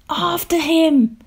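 high, drawn-out rallying cry. A narrator's reading. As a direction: call the chase, slow and high. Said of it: "the trio cried".

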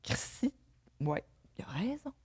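whispered realization to oneself; barely voiced, thinking to oneself; hushed inner thought. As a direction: think aloud in a very soft whisper. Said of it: "j'étais comme".

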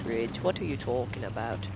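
ordinary talk

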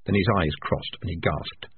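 ordinary delivery, nothing marked

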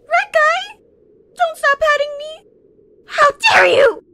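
very high, loud squeal; piercing tantrum cry; indignant shriek. Acted. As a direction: screech in childish outrage. Outraged that petting stopped.

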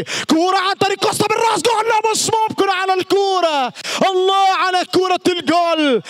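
fast high rush into a goal cry; delighted goal call. Real, not acted. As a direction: roar as the header goes in.

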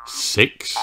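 ordinary delivery, nothing marked

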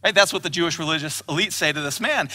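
usual speaking voice

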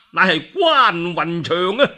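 plain reading voice

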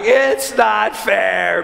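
put-on high, drawn-out toddler wail; mock crying. Son wails that it's unfair, mock crying. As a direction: wail it like a little boy in tears. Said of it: "Eli starts crying".

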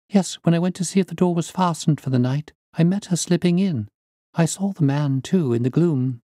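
put-on high-pitched young woman's account; earnest niece witness. Niece telling the detective about the maid slipping in, high and earnest.

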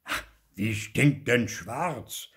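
put-on dismissive old uncle, stretched words; sceptical Onkel Oskar. A narrator's reading. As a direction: question it with drawn-out disbelief. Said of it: "fragte Onkel Oskar".

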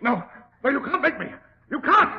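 a cornered man's shriek of refusal, building to a yell; terrified, climbing protest that ends in a shout; panicked refusal. Acted. Doctor panics, refuses the injection.

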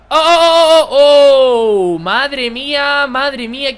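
loud, high, drawn-out cries of alarm; real panic as the ball nearly goes in; shocked outcry. Real, not acted. Near miss in his own goal. (grito de susto alto y prolongado)

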